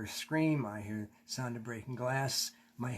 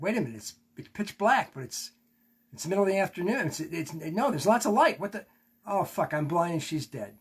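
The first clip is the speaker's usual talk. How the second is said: panicked; 5 semitones higher, 5 dB louder than usual. Retold or imitated. Panicked realization in the crash.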